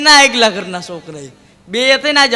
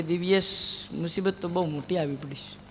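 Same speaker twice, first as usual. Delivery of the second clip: low, soft mournful friend; depressed friend moaning about a problem; gloomy lament; 5 semitones lower, 11 dB quieter than usual. Retold or imitated. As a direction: sigh it out like a man in trouble. Gloomy friend, head in hand.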